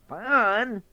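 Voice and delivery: high, loud surprised fox. Acted. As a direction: snap up the word in surprise.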